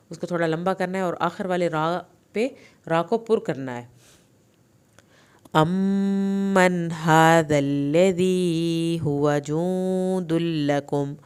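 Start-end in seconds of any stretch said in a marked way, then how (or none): 5.6-11.1 slow, melodic tajweed chant; measured, devout recitation; Quran recitation. Shown as a demonstration. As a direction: chant the verse slowly and fully. Full recitation of passage.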